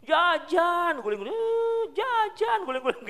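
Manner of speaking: put-on high, loud wailing child demanding snacks; whining child. Child throwing a tantrum in front of a crowd, whining high. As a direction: wail it like a kid rolling on the ground.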